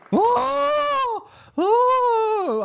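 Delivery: loud high cries of delight; excited shouting. Real, not acted. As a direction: yelp out with glee. Reacting to flashbang clip.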